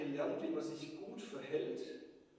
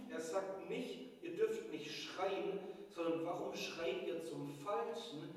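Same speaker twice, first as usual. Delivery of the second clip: preaching at full force; 3 semitones higher, 4 dB louder than usual. Acted. Preacher accusing the people through Micha, fervent. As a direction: drive the accusation home from the pulpit.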